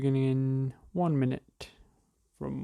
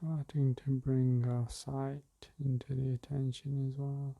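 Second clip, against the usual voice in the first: guided meditation; slower than usual. Acted. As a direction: ease listeners into the breath gently.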